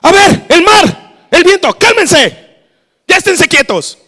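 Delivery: high, very loud scolding; exasperated parent-like shouted command; angry Jesus. Acted. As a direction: bark orders at wind and sea. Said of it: "Jesús parándose todo enojado, así".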